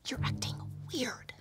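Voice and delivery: hushed scolding whisper. Real, not acted. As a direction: whisper it sharply under breath. Hushed reproach at table.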